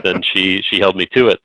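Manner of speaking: ordinary talk, nothing marked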